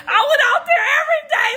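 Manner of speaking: high, fast, loud and laughing; excited shout. Real, not acted. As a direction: burst it out while laughing.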